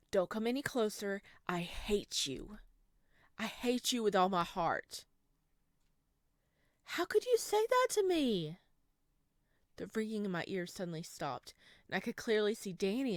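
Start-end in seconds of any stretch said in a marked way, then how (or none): 0.1-2.6 terrified whisper. Acted. Samuel warding off his mother, terrified whisper. 3.4-5.0 angry outburst. Acted. Samuel yelling hatred. 6.8-8.6 much higher, louder wounded cry; hurt high cry. Acted. Danny to Samuel after his outburst, hurt cry.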